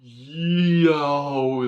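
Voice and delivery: loud, high, long-stretched exclamation; awestruck delight. Real, not acted. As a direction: stretch each word out in amazement. Amazed at first sight of knife.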